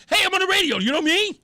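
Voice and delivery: mock show-off. Host mocking the guy who brags he is on radio, loud and high. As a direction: burst in like a self-important radio guy, mocking it. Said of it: "run in the door just like".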